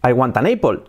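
Spanish-accented English, "apple" as "EY-pol"; word-by-word English with Spanish vowels; learner's Spanish accent. Shown as a demonstration. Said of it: "Si yo en mi cabeza tengo".

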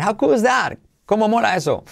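high, delighted exclamation; excited. Real, not acted.

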